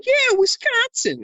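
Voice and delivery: long high whooping Yeah, Wisconsin; excited cheer. Retold or imitated. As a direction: whoop it back like a fellow fan. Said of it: "someone responding to them".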